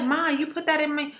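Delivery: a hint of a complaining little girl; quoting her daughter's complaint. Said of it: "She was like".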